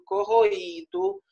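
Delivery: usual speaking voice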